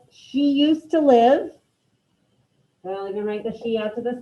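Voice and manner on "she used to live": she used to live read slowly and a little louder, with a rise on to; teacher giving the class the last phrase; slow dictation. Shown as a demonstration. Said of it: "Let's go with".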